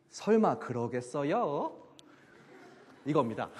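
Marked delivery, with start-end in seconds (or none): 0.1-1.8 "설마 그러겠어요?" drawn out slowly, low, then swinging up at the end; "설마 그러겠어요?" with slow, complicated rise-and-fall intonation; exaggerated intonation. Shown as a demonstration. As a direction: stretch "설마 그러겠어요?" into a winding, sceptical tune. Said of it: "꼭 그렇게 복잡한 억양으로 얘기를 해야".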